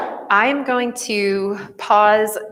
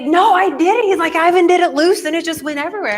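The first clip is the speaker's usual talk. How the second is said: put-on high, loud, fast kid protest; kid's protest; 9 semitones higher, 5 dB louder, faster than usual. Child insisting to teacher he didn't shake it, high and fast. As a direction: defend yourself like a flustered kid. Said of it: "And he was like".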